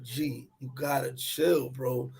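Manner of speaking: slow, low and soft; calming cousin. Retold or imitated. Cousins grab him and tell him to chill, calming. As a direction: talk a friend down, slow and hushed.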